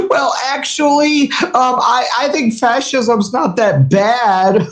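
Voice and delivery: drawn-out nerdy mimicry; mocking pedant. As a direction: ham up a timid know-it-all. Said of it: "these fucking pussies come in and be like".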